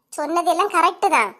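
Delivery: usual speaking voice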